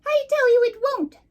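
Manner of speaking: shrill furious bee queen, very high and loud; angry shout. Acted. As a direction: stamp and scream it at Rabbit. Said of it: "stamped her foot and shouted".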